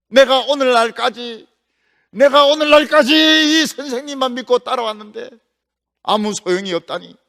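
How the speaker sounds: bitter complaint. Acted. Elisha complaining he followed his teacher for nothing, bitter loud protest. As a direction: pour out the grievance with force.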